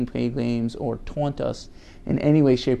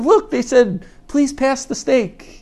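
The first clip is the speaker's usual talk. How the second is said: high-pitched, excited translating of babble; delighted parent; 11 semitones higher, 4 dB louder, slower than usual. Retold or imitated. Parent claiming the baby spoke a sentence, high and excited. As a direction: gush over the baby's supposed words. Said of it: "and they're like".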